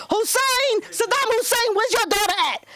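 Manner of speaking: high taunting schoolkid voice; high playground taunt; mocking kids. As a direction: tease like bullies in the hallway. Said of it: "That's what they did to me".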